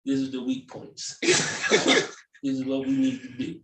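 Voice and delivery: low, hushed conspiratorial boss voice; brief the troops like a scheming ringleader; plotting dog boss. Pretend.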